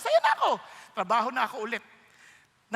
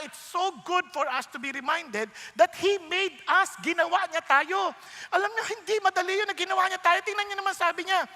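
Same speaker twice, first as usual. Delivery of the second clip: normal talking voice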